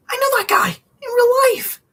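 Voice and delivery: very high, loud excited outburst; thrilled to spot someone you know; excited squeal. Real, not acted. Spotting a friend outside while streaming, excited squeal.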